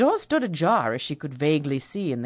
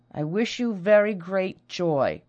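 mechanical; 3 semitones higher, slower than usual. Acted. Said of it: "Rosalind repeated mechanically".

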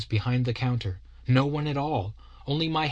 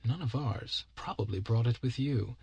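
undertone; 5 dB quieter than usual. A narrator's reading. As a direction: say it aside so the boy can't hear. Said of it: "also in an undertone".

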